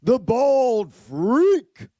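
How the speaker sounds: slow, stretched, high announcer shout with stress on freak; announcer demo. Shown as a demonstration. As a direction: draw out "The bald freak" and punch freak. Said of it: "I would do something like this".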